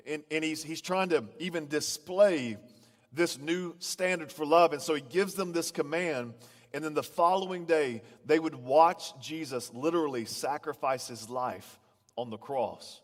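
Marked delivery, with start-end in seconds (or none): none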